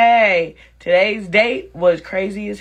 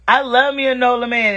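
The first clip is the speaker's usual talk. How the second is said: normal talking voice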